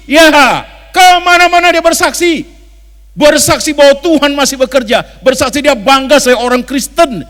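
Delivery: loud, high, ringing pulpit voice; preaching at full force. Acted. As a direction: preach it out to the whole church.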